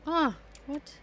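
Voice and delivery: ordinary talk, nothing marked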